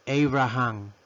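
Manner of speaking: Abraham said "AY-bra-han"; Abraham in three drawn-out syllables, "EI-bra-han"; English name pronunciation. Shown as a demonstration. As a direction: model Abraham with a first "ay". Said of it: "pronunciación es".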